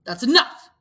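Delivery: loud, sharp command. Acted. Ralph stopping Piggy's complaint, sharp and loud. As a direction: cut him off hard. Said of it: "said Ralph sharply".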